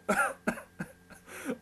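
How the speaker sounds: high burst of laughter; teasing laughter. A narrator's reading. Family amused as the sister blushes, laughing out loud. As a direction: laugh out loud without words.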